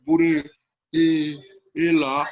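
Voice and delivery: slow, loud mock Liverpool accent; Scouse accent. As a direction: drawl it out like a Liverpudlian. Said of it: "When you went to Liverpool, you hear that".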